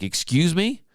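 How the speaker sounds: rising, baffled excuse me; half-shocked polite protest; startled excuse me. Retold or imitated. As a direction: caught off guard at gunpoint. Shotgun still aimed at him.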